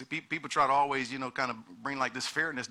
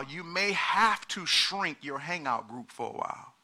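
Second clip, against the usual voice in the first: preaching at full force; 4 semitones higher, 7 dB louder than usual. Acted. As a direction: drive the point home to the church.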